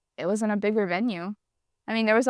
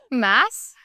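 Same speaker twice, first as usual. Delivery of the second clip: laughing prompt; 3 semitones higher, 5 dB louder than usual. Real, not acted. Coaching friend through hard word.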